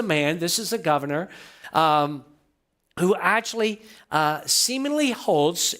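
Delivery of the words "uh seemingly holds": seemingly drawn out slow and weighted; stress on seemingly. Shown as a demonstration. Preacher to congregation, weighting seemingly slowly. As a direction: lean hard on seemingly, slowly. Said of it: "and I emphasize the word seemingly".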